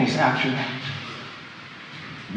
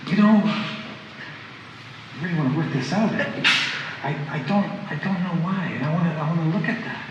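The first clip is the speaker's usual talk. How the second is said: softer and unhurried, reaching out; friendly, open; 8 dB quieter than usual. Retold or imitated. Speaking to a hurt coworker, friendly and softer. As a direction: invite her in gently. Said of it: "I was a friendly".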